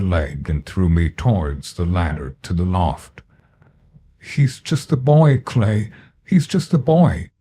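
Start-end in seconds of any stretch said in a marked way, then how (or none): 4.2-7.3 begging him to stop; pleading woman. Acted. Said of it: "Missus Louise kept muttering".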